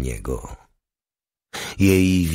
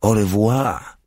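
higher, bright French goodbye through a forced smile; forced cheerful farewell; 4 semitones higher, slower than usual. A narrator's reading. Dora correcting her legionnaire with a forced smiling au revoir. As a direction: au revoir lifted up, insisting on a lighter goodbye. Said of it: "w wymuszonym uśmiechu".

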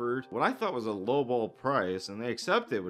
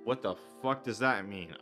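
usual speaking voice

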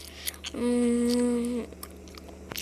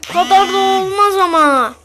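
high, loud frustrated outburst; exasperated shout; 8 semitones higher, 12 dB louder than usual. Real, not acted. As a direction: yell it in disbelief. Frustrated at game glitch.

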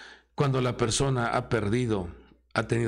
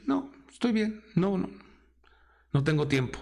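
dismissive brush-off; 4 semitones higher than usual. Retold or imitated. Acting careless person refusing God.

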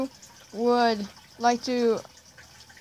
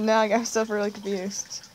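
ordinary talk

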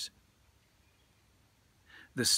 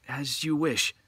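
lightly voiced Hoichi; 7 semitones higher, 5 dB louder than usual. A narrator's reading. Hoichi agrees.

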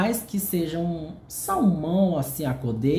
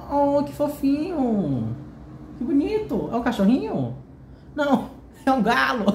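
cooing; 8 semitones higher than usual. Real, not acted. Admiring the cute drawing on the book's back, high cooing. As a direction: coo at the little drawing.

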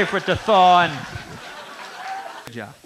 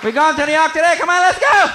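much higher and louder, revved-up pilot; eager pilot; 9 semitones higher, 4 dB louder than usual. Retold or imitated. Comic plays wild pilot pumping up the passengers, excited and loud. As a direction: hype the passengers like a wild guy.

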